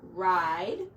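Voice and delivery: drawn out very slowly, word by word; slow reading. Shown as a demonstration. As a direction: read the sign slowly again for the child. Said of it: "she wrote it right here".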